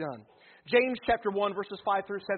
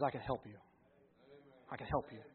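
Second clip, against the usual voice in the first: much softer, lower, tender voice of God; gentle reassurance; 6 semitones lower, 7 dB quieter than usual. Acted. God gently answers the desperate believer, soft and reassuring. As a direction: hush down and comfort the desperate one.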